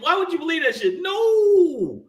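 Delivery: high, fast, shouted protest; indignant denial. Retold or imitated. Viewers objecting to the show idea, shouting high and fast. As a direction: shout it down as outrageous. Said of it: "then they went".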